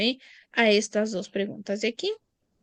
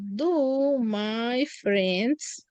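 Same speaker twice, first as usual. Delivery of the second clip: very slow, stretched prompt; slow cueing voice; drawn-out prompt; much slower than usual. Shown as a demonstration. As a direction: hold each word so students finish it. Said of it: "teacher prompts slowly".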